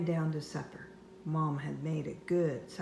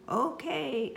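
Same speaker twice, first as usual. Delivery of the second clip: yelled; 9 semitones higher than usual. Acted. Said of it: "I yelled back".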